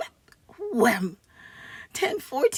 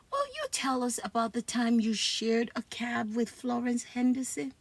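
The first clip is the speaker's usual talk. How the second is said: ordinary delivery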